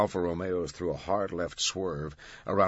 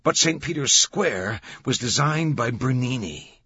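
higher, louder, slow emphatic reveal; triumphant; 6 semitones higher, 6 dB louder than usual. A narrator's reading. Langdon reveals Bernini to Vittoria, triumphant. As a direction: deliver the clincher triumphantly. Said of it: "triumph in his voice".

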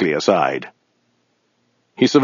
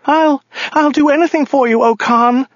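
very high pleading woman's voice; terrified stammer; 3 dB louder than usual. A narrator's reading. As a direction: stammer it out in high-pitched panic. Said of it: "stammered the woman".

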